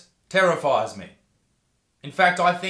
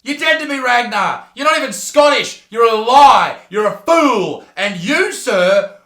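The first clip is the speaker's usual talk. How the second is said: high, loud, hammed-up denunciation; over-the-top outraged yelling; mock furious shout; 5 semitones higher, 10 dB louder than usual. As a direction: shout it like a theatrical disowning. Mock denouncing of Ragnar.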